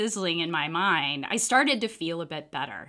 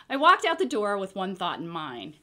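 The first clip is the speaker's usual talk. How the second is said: plain reading voice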